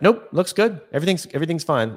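high, quick dismissive patient; high, quick brush-off from a patient keen to leave; breezy patient brush-off. Retold or imitated. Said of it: "people are inclined to be like".

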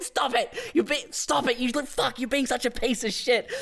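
high, strained mock scolding; imitating exasperated dad. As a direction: try urgently to shut the kid up without shouting. Retold dad trying to silence son.

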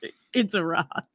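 understated deadpan impression; Steve Gupta. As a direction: lightly put on his manner. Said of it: "That's my best Steve Gupta impression".

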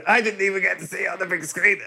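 high, fast petulant complaint; whiny dying man. Pretend. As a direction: rush the gripe out feebly.

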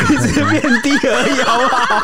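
high-pitched laughing protest. Real, not acted. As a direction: laugh through the words. Laughing banter over the voice.

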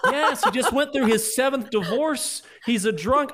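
high, loud laughing jokes. Real, not acted. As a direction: giggle through the joke. Joking about Run Line Jenkins.

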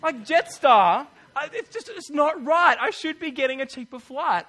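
worked-up complaint about the airline; frustrated outburst. Real, not acted. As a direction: let the frustration burst out. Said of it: "so frustrated".

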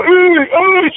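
high excited hooting like airport fans. Retold or imitated. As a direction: hoot at him from down the terminal, up high. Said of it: "and they'll go".

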